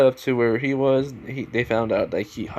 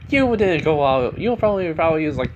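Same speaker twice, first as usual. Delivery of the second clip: raised, high-pitched accusation; acted scolding; 5 semitones higher, 4 dB louder than usual. Katsuki challenges Bond on holding back, loud high scolding.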